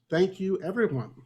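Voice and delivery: ordinary talk, nothing marked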